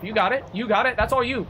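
high, lilting encouragement; high-pitched cheering on. Real, not acted. Streamer egging the game on with the lights, high-pitched cheering.

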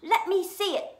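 high, loud, insistent cry; demanding. A narrator's reading. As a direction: demand it like a spoilt boy. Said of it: "demanded Dudley".

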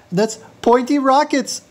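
rising, high excited confession; mounting obsession. Acted. Faux tycoon confessing his one little problem, mounting obsession. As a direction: let the pitch climb as it slips out.

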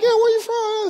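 high, loud squeaky voice for the nurses; mock high nurses. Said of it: "They're like".

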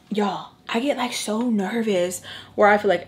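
ordinary delivery

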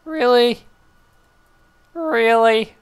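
can't believe it; incredulous. Real, not acted. Gamer exasperated at the game, high disbelief. Gamer stunned by bad luck.

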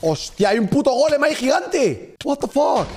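startled shout. Real, not acted. Gamer shouting in shock at a giant golem. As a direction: yell in surprise as a golem looms.

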